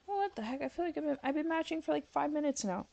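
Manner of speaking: high frustrated whine; high exasperated. Real, not acted. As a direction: whine in disbelief. Frustrated at game.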